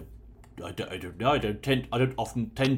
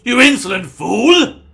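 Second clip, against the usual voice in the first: furious high shout; evil queen; 12 semitones higher, 20 dB louder, slower than usual. Acted. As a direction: explode in outraged fury. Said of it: "He can't even control his temper".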